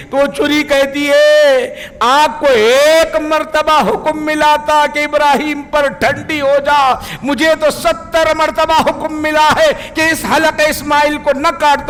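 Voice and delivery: preacher at full force, high; impassioned preaching. Acted. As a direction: push the knife's answer up with fervour. Preacher climbs while voicing the knife.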